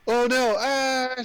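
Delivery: high, loud retold panic; acted-out freak-out with a drawn-out wail; mock freaking out. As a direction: cry out in hammed-up alarm. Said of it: "she starts freaking out".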